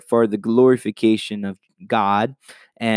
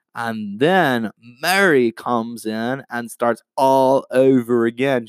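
normal talking voice

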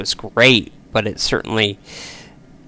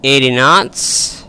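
pilot takeoff callouts; 4 semitones higher, 5 dB quieter than usual. Acted. Calling speeds during takeoff roll.

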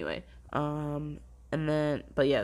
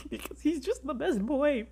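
higher, laughing through near tears; laugh-crying; 11 semitones higher than usual. Real, not acted. Overcome by favourite character.

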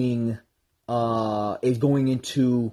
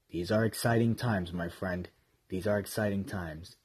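much softer, low and hushed; 6 semitones lower, 11 dB quieter than usual. Real, not acted. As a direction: say it under your breath. Repeated in hushed aside.

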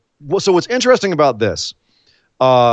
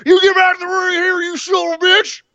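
much higher mock bellow of rage; Braun Strowman roar. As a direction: yell it across the ring like a furious giant. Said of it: "remember Braun Strowman on Sunday".

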